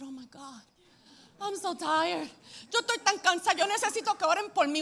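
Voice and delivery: hammed-up exhausted begging; mock tired plea. Preacher mimicking her tired self, pleading. Pastor retells being exhausted.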